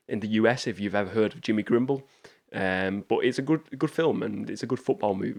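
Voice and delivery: normal talking voice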